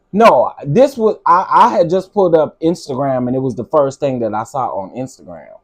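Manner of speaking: ordinary delivery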